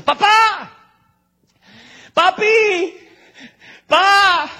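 put-on high, loud child's desperate cry. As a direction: call out to papa from the altar, desperate. Isaac calls from the altar.

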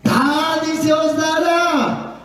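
high, drawn-out scripture call; proclaiming. Real, not acted. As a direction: stretch it out like a chanted promise. Proclama el versículo ante la congregación. (proclamación aguda y alargada)

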